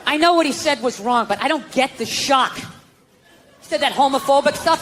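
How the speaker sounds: ordinary delivery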